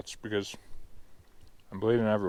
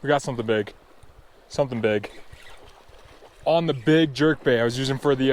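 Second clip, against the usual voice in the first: ordinary talk, nothing marked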